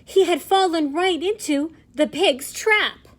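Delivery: plain reading voice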